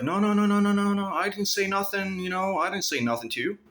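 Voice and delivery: high, pleading mock-coward voice; scared backing down. Stalker backing down, imitated as a high scared coward. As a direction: whimper out a frightened denial. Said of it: "started acting like a pussy".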